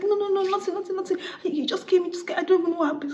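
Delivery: very high mock-frightened protest; panicked pleading. Bride pleading with the angry groom, panicked pleading. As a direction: plead innocence in a squeaky high voice.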